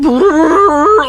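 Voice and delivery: lip-buzzing brass fart; imitated fart noise. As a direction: blow a raspberry like a tuba. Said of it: "elephant saxophone".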